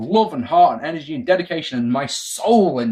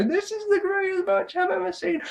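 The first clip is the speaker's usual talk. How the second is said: much higher, hammed-up awe; awed excitement; 5 dB quieter than usual. Fans on the night of the match, mock gushing awe. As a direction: gush like a fan watching it live. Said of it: "on the night, you go".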